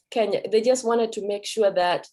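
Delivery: normal talking voice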